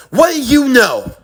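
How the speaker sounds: high, shouted accusation; anguished outburst. Acted. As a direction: build from challenge into a pained shout. Acted outburst over lost family.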